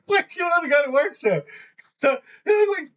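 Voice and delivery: high squeals of laughter; laughing hard. Real, not acted. Cracking up telling a friend the story, laughing hard. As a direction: talk through real laughter.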